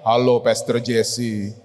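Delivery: hammed-up deep voice under a pretty look; low, slow mock manly greeting; deep male voice. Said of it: "waktu ngomong, ternyata dia laki".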